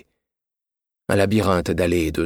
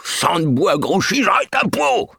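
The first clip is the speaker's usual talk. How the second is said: furious shout; 11 semitones higher than usual. A narrator's reading. Drunk old man shouting at the coachman, furious high yell. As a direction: shake your fist and bellow it. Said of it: "en hurlant".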